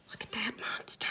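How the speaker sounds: scared breathless whisper. Acted. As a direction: whisper it so the monster won't hear. Hushed fright at the pond.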